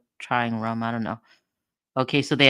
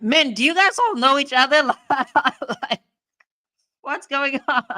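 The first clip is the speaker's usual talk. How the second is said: surprised; 11 semitones higher, 5 dB louder than usual. Real, not acted. Sudden surprise at guests.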